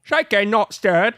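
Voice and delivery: hammy suave spy voice; James Bond impression. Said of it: "Do a Pierce Brosnan James Bond".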